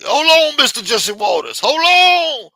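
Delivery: loud, high hollering hail; big mock holler to stop someone; mock shout. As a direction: holler it out with long drawn vowels. Mimicking someone hailing Jesse Waters.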